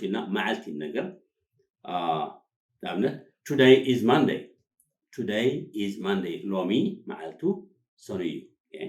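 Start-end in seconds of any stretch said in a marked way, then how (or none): 3.5-4.4 louder, in one connected phrase; natural model sentence. Shown as a demonstration. Teacher models the answer. 5.1-6.4 slow, measured, words set apart; slow model repetition. Shown as a demonstration. Teacher repeats it slowly.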